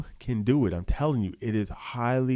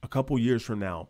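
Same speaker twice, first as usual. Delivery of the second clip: normal talking voice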